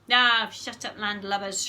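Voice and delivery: loud pirate shriek. A narrator's reading. Henry shrieks at the girls from his hammock. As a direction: bellow it over the fence. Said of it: "shrieked horrid Henry".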